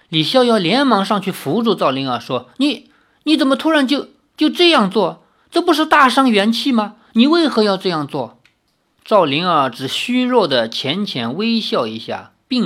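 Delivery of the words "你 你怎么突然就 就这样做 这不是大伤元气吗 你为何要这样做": high, stammering alarm; worried, urgent questioning; anxious concern. Acted. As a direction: question a friend in worried disbelief. Alarmed friend props up drained healer.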